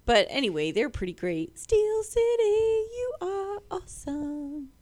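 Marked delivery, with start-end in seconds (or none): 1.6-4.7 high, slow gushing praise; a lilting, drawn-out compliment; sing-song shout-out. Pretend. Shout-out to the pizza place.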